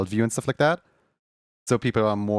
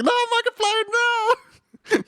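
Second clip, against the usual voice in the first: very high, a touch louder panicked cry; 3 dB louder, slower than usual. Retold or imitated. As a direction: squeal in mock panic at the cutout. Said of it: "having a panic attack".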